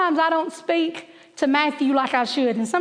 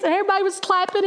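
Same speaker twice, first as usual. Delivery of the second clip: normal talking voice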